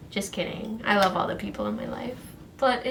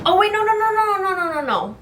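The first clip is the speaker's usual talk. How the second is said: sudden alarm; 9 semitones higher, 5 dB louder, faster than usual. Real, not acted. Suddenly remembers forgotten step.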